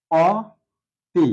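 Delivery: অতি drawn out very slowly, open অ; slow spelled-out অতি. Shown as a demonstration. Teacher to students, drawn-out spelled অতি. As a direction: stretch অতি as written. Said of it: "আর এখানে নিতে পারি".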